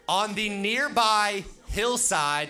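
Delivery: loud, high, drawn-out read-aloud; big, high-pitched group-recitation call; rallying call-out. Shown as a demonstration. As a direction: belt it out slowly so everyone joins in. Rallying audience to say it along.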